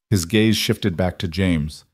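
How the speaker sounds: plain reading voice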